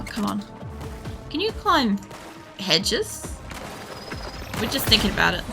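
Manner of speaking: normal talking voice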